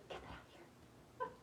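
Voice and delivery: slow, soft breathy whisper to herself; whispering while fighting tears. Real, not acted. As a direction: whisper it slowly, shooing the tears away. Pushing away tears, repeated whispered.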